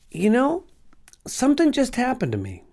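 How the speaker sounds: high, choked-up retelling; overwhelmed. Retold or imitated. Husband telling his wife about the vision, choked-up and high. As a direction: voice lifting with emotion as he recalls it.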